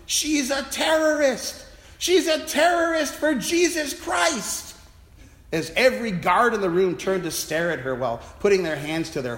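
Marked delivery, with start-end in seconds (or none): 0.1-4.8 high, slow, loud accusing shout; shouted accusation. Retold or imitated. Raving man shouting at the security guard. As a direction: yell it out at the guards. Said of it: "pointed at my colleague and shouted".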